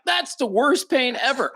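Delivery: high, whiny mock wail; mock pain. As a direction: squeal about the Q-tip. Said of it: "you'd be like".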